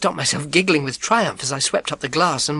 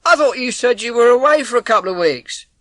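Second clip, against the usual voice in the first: high, louder call through the door; milkman calling; 10 semitones higher, 7 dB louder than usual. Acted. Milkman calling through the front door, loud and concerned. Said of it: "I knew his voice".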